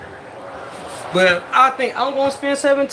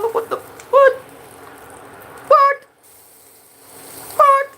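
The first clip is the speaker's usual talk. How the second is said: high, loud startled "what?"; shocked; 4 dB louder, slower than usual. Real, not acted. Scene: cook sees the grill flare up, shocked. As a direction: cry out in real surprise at the flames.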